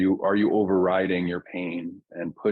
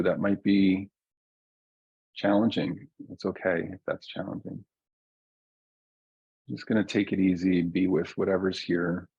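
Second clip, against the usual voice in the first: guided meditation. Real, not acted. Teacher settling a group into their bodies, calm guided meditation. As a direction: ease listeners down gently, leaving long silences. Leads a slowing-down grounding meditation.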